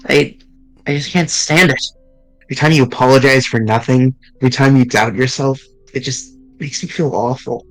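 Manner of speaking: ordinary delivery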